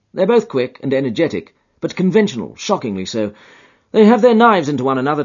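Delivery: Holmes voice. A narrator's reading. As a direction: rattle it off with dry relish.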